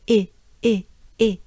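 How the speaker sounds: "E" as French é, the last vowel of café; short, clear "ay" without glide for "E". Shown as a demonstration. Teacher shows another familiar French sound, café E. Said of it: "you can think of the word café".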